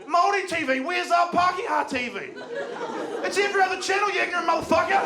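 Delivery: high mock-outraged yell; mock angry commenter. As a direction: shriek it like an outraged online commenter. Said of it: "Everyone on there's like".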